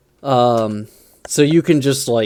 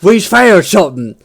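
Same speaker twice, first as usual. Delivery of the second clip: loud, much higher orc yelling; excited orc; 6 semitones higher, 8 dB louder than usual. Retold or imitated. Orc running up to the boss, loud excited yell. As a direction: burst in shouting news at the boss. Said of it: "it's just like".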